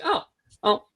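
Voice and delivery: ordinary talk, nothing marked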